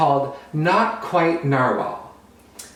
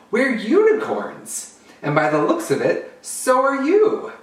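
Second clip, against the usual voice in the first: higher, slow, sparkly unicorn reveal; 9 semitones higher than usual. Acted. Unicorn tells narwhal he is one too, high and slow. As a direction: announce it like a magical unicorn.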